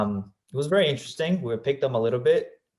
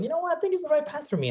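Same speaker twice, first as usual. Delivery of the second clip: mock younger self; faster than usual. Teen self voiced, high pitched.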